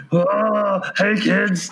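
gruff furry monster voice; Cookie Monster voice. Pretend. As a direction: growl it like a googly-eyed monster. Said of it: "Cookie Monster voice".

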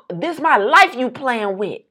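a lightly voiced angry owner; mock angry owner. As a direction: echo his dramatic line. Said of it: "he was".

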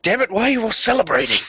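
fast, high, exasperated outburst; aggravated. Acted. Bean snapping at the celebrating group, aggravated and fast. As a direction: blurt it out, fed up with the party. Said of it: "seems a bit aggravated".

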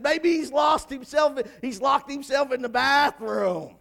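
slow, shouted taunt; mocking. Elijah needling Baal's prophets about their god, loudly mocking. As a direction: jeer loudly, high and drawn out. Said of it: "kinda mocking them a little bit".

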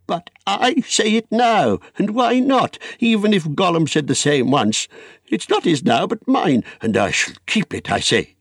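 put-on high, possessive old hobbit; indignant high voice settling into firm resolve; defiant Bilbo. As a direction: insist stubbornly in a high, heated voice. Bilbo defies Gandalf over the ring.

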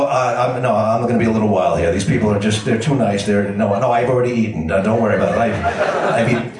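imitated phone excuses in a low register; low apologetic excuses. Guest phoning his wife from an overfriendly home, low placating excuses. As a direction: keep it low and halting, placating.

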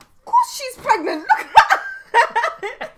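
high, loud mock schoolkid with a laugh; pupil's friend. Friend scoffs at child's apology about teacher, high laughing imitation. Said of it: "their friend would be like".

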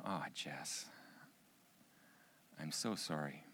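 low, soft consoling; tender sympathy. Retold or imitated. Father hears daughter is bullied at school, tender sympathy.

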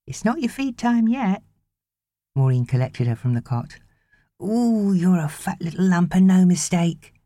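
put-on high, cooing baby talk; nanny cooing at a baby. A narrator's reading. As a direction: coo at the baby fondly.

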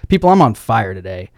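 ordinary delivery

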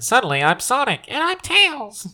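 talking with the nose held shut; pinching nose. Retold or imitated. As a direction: hold your nose and do a cartoon voice. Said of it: "you hold your nose and do this".

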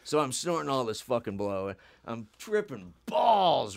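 ordinary talk, nothing marked

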